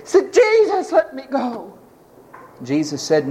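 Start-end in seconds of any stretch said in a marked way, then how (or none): 0.3-1.7 high-pitched mock wail, falling away; begging. Healed man begs Jesus to let him go, wailing plea. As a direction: plead like a desperate man, voice leaping up. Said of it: "he begged".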